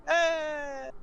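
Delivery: high, held mock cheer; crowd cheer. Whole tavern cheers the free drinks, high cheer. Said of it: "everyone in the tavern's like".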